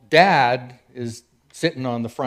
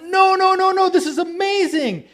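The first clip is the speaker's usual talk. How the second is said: much higher and louder, brushing the apology aside in delight; ecstatic father; 8 dB louder than usual. Retold or imitated. Father waving off his son's apology, ecstatic and much higher. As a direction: refuse the apology with pure joy. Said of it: "So the dad is, like, ecstatic".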